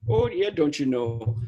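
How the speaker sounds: put-on slow, drawn-out Minnesota accent; a Minnesota "oh yeah, don't you know" in Fargo accent. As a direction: lean into the Upper Midwest Fargo sound, slowly. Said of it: "I start going Fargo".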